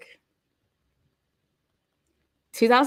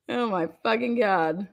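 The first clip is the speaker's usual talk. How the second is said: ordinary talk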